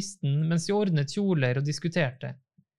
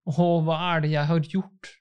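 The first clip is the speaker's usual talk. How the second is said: ordinary delivery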